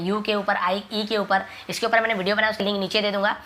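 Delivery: ordinary delivery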